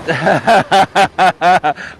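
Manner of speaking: a real, amused laugh. Real, not acted. Host laughing at the crew's joke as they leave, real laugh.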